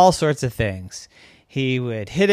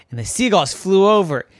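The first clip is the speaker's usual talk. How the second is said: ordinary delivery, nothing marked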